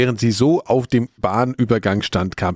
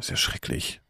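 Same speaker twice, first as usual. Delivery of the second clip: whisper; 10 dB quieter than usual. Real, not acted. Reacting to a grim murder story.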